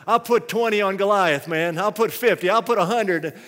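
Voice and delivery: loud, eager crowd bettor; shouted bets. Acted. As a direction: call out rising wagers over a crowd. Acted Philistines shouting out bets.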